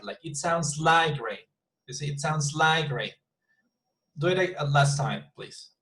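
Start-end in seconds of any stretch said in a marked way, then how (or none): 0.2-1.5 staircase tune peaking on a stretched "like"; stress on like. Shown as a demonstration. Teacher models again for struggling student, slowly stressing "like". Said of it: "imagine the staircase in your head". 2.1-3.1 "like" as the peak of the tune; stress on like. Shown as a demonstration. Teacher repeats model for student, stressing "like". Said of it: "imagine the staircase in your head".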